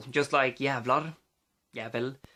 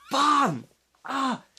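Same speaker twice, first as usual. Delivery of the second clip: high, loud Swedish outburst; acted pained yell; 11 semitones higher, 5 dB louder than usual. Swedish person stubbing their toe, acted high yell. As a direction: burst out as if the toe just got stubbed. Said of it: "very likely to yell out".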